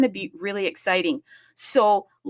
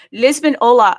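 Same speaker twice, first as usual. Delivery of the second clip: much louder, calling out hola; loud greeting; 4 semitones higher, 13 dB louder than usual. Real, not acted. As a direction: project the greeting to Lisbon. Universal greeting to Lisbon.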